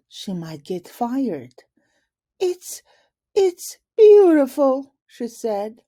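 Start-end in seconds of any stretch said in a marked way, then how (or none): 2.4-4.9 high, loud, stammered gush; overdone nervous enthusiasm; nervous fake praise. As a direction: fake the delight, covering panic. Miss Moore faking praise nervously.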